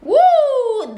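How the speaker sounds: high loud whoop; impressed high-pitched woo; delighted "woo". Real, not acted. Reacting to the sprayed scent.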